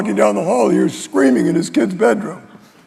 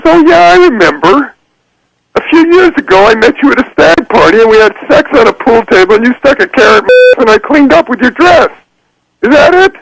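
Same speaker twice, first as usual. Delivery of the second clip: high, loud, excited recall; eager recollection; 4 semitones higher, 10 dB louder than usual. Acted. Man recalling a wild party, excited. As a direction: pour out the memory, loud and high.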